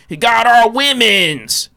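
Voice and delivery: high, slow, drawn-out cry of outrage. Acted. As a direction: parody a scandalized accuser, stretching the words. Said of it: "you hear a lot of those stories".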